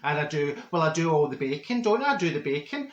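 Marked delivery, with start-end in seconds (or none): none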